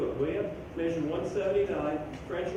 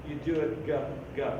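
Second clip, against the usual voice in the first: normal talking voice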